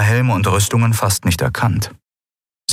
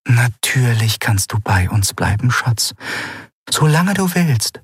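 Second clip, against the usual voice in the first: tender mother; 5 semitones higher than usual. Acted. Mother comforting her daughter.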